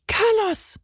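high urgent call. A narrator's reading. Benny calls Carlos facing the dog. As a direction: call his name up high. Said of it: "rief Benny".

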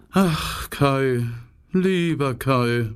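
slow, sighing longing. A narrator's reading. Gerda longing for her lost friend Kai, sighing. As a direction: breathe out the name with a sigh. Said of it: "seufzte Gerda".

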